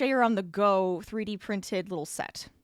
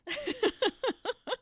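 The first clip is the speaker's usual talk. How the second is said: high laugh; breathy giggle. Real, not acted. Laughing before breath remark.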